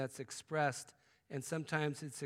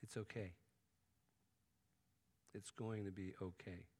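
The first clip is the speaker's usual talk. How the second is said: soft, low reassurance; small gentle voice; 5 semitones lower, 10 dB quieter than usual. Retold or imitated. God's small voice gently reassuring a disappointed believer. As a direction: comfort someone who is hurting. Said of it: "you hear this small voice say".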